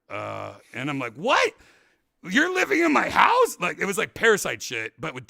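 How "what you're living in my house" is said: much higher, louder disbelief; indignant high yelp; shocked. Retold or imitated. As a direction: cry out in outraged surprise. Shocked at Doug living there.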